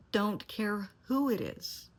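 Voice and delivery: slow, high and loud, each word leaned on; slow emphatic insistence. Real, not acted. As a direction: drive it home hard. Emphatic warning to parents.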